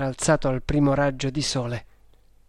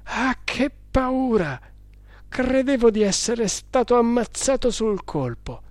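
frightened; 9 semitones higher than usual. A narrator's reading. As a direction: still reeling from the fright. Terrified victim recounting attack.

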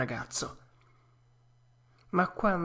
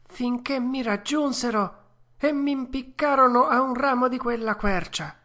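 Pinocchio, moved; 8 semitones higher than usual. A narrator's reading. Puppet thanking the fairy, high and tender. Pinocchio continues his story.

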